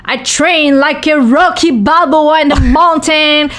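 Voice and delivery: put-on high, slow, drawn-out bragging in French-accented English; laying it on thick. Boxer retelling how she embellished training for journalists, laying it on thick. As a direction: stretch each feat out, hammed-up for effect. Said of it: "j'en ai mis".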